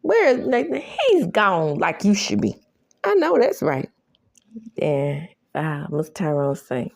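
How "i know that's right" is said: normal talking voice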